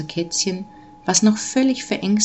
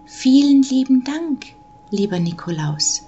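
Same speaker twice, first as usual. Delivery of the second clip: put-on high, polite child voice, easing down; well-behaved child; 6 semitones higher than usual. A narrator's reading. Child thanks Nikolaus for the gift, nicely polite. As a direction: thank him like a good little child. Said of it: "bedankte sich eine artige Stimme".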